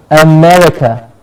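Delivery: America with a clear "me", not "ma"; correct America. Shown as a demonstration. Teacher modelling America for students, jaw closer. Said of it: "not a ma, a me".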